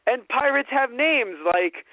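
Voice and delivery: high, slow, emphatic protest; mock insistence. Director defending the pirate persona, mock insistent. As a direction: insist stubbornly, hammed up.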